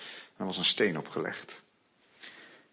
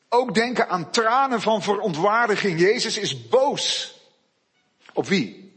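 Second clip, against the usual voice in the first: loud, high, fervent sermon; full-force preaching; 8 semitones higher, 5 dB louder than usual. Real, not acted. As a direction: thunder against death and evil.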